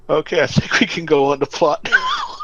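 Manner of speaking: laughing as you move things along. Real, not acted. Laughing while moving the discussion on.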